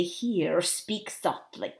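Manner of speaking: hushed caution. Acted. Captain cautions the cat secretly.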